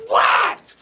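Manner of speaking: high, loud jolted "What?"; startled shout. Retold or imitated. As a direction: yelp it as if just woken.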